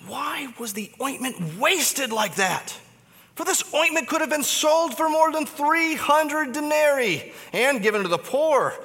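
mildly indignant, rising high; indignant protest. Acted. Disciples protesting the wasted ointment, indignant. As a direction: voice the disciples' outrage. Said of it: "they said to themselves indignantly".

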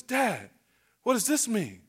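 lightly voiced curious little boy; puzzled boy. Little boy asks dad about the preacher's watch, puzzled and hushed. Boy's question voiced in story.

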